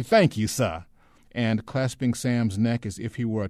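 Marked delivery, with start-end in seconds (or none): 0.0-0.8 loud, eager, deferential thanks; servile, ingratiating Southern act; prompt thanks. A narrator's reading. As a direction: thank the soldier at once, humbly. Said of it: "said Shine promptly".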